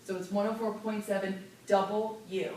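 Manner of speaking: "double U" fully spelled out in the call sign; crisp, clear call letters with "double U"; clear station ID. Shown as a demonstration. Teacher models the station name clearly. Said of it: "This letter is two words".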